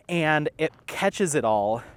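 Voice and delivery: ordinary talk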